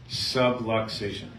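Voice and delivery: Subluxation said slowly, each syllable sub-lux-a-tion clear; careful pronunciation. Shown as a demonstration. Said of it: "Not a subway station".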